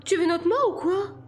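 high, panicked female voice; frantic woman passenger; terrified squawking. A narrator's reading. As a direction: demand it in high-pitched alarm. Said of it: "elle piaillait de terreur".

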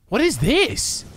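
high, slow amazed gasp; stunned by the card reveal; breathless disbelief; gasping surprise. Real, not acted.

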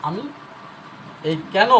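ordinary delivery

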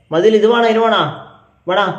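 high, loud offering question; loud calling. Real, not acted.

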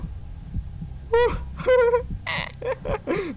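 long, very high shaky laughter; nervous laugh. Real, not acted. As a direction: laugh off the terror. Risa tras el susto. (risa nerviosa muy aguda)

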